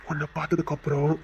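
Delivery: low, hushed confession; tender repetition. Acted. Suitor telling her life changed on seeing her, soft and low.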